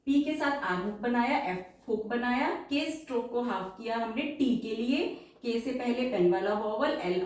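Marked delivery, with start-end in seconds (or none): none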